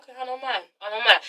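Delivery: cool, half-hearted agreement; cold shoulder. Acted. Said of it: "he's kinda giving her the cold shoulder".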